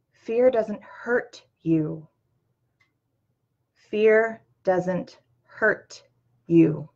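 slow, deliberate, every word weighed; very slow, firm reassurance; slow emphatic. Real, not acted. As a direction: lay it down slowly so it sinks in. Slow emphatic repeated message.

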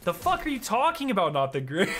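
high-pitched exaggerated disbelief, easing off; hammed-up indignant comeback; mock outrage. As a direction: blurt it high as a joking retort. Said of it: "I didn't say it like that".

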